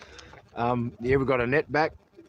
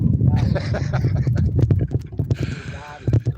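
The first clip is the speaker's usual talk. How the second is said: long, loud helpless laughter; real belly laugh; 5 dB louder than usual. Real, not acted. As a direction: crack up loudly at your own joke. Cracking up at own joke.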